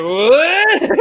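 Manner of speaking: mock collective gasp; shocked what. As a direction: react like the whole room is stunned. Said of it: "everybody's like".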